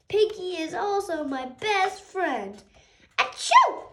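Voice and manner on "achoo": very high, loud achoo; huge sneeze. Acted. Gerald sneezes at Doctor Cat, huge loud sneeze. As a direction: explode into a sneeze.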